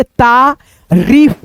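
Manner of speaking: तारीफ with the री vowel drawn out very long; stretched long ee. Shown as a demonstration. Teacher dictating तारीफ to pupils, long ee stretched out. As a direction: say तारीफ slowly, holding the long ee in री. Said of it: "पहले उच्चारण सुनेंगे अच्छे से".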